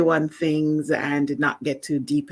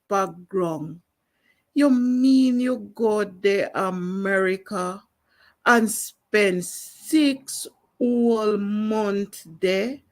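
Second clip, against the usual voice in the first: raised, lilting Jamaican Creole poem reading; performing a Louise Bennett poem in broad Jamaican patois; Jamaican patois poem recital; 6 semitones higher than usual. Shown as a demonstration. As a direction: recite it like a Jamaican market woman scolding. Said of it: "this is an extract ... which is called No Little Twang".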